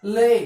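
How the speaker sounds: ले gliding e-i, said loud; correct version. Shown as a demonstration. Teacher shows the correct sound after the spoken form. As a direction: punch ले as a diphthong. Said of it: "क्या होता है?".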